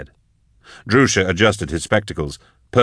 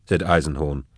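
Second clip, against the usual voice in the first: usual speaking voice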